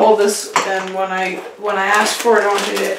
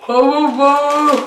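cheeks stuffed full; 4 semitones higher than usual. Real, not acted. Chubby Bunny with mouth stuffed.

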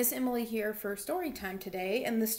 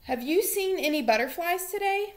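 higher, eager little bunny voice; curious bunny; 7 semitones higher, 5 dB louder than usual. Acted. Billy Bunny asks his friend Molly, high and curious. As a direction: ask it like a small excited bunny. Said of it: "Billy asked his friend Molly Mouse".

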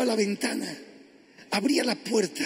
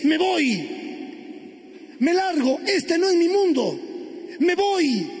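raised pitch, emphatic walkout; defiant farewell; 5 semitones higher than usual. Acted. Wife writing to leave her husband, defiant. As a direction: declare it forcefully, one burst after another.

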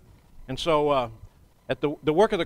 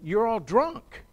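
high, drawn-out mock accusation; slow, high-pitched imitation of a jeer; 6 semitones higher, slower than usual. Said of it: "they did just basically accuse these people".

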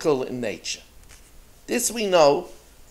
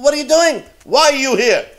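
high, loud demanding yell; angry shout; 9 semitones higher, 8 dB louder than usual. Retold or imitated. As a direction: yell at the intruders. Miser confronts the seven townsmen.

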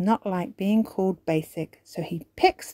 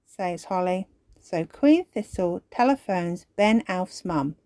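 ordinary delivery

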